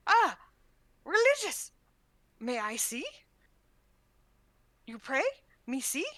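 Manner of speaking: loud, very high delight, then smaller questions; excited creature. Acted. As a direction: burst out with excitement at the word. Krell excited hearing religious services.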